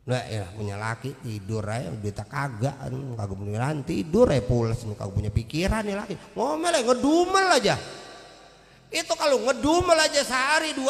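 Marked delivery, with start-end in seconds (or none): 0.0-6.2 low-pitched grumble, as if to oneself; inward grumbling. Acted. Wife silently fuming about her sleeping husband, grumbling low. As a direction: grumble under your breath about a useless husband. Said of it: "hatinya ngedumel".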